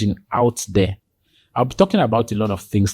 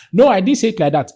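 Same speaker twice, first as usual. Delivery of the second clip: higher, quicker protest; heated denial; 9 semitones higher, 4 dB louder, faster than usual. Acted. Cornered opponent denying what they said, heated protest. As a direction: protest fast, pitch jumping up. Other person denies again, thrown off balance.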